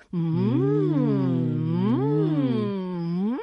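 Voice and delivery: closed-mouth humming mm. Shown as a demonstration. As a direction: hum it as if chewing. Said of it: "Otro sería hacer, eh, Ms".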